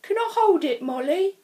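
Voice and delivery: begging to hold it, high and loud; a classmate pleading to hold the crystal, high and loud; Aaron. A narrator's reading. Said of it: "asked Aaron".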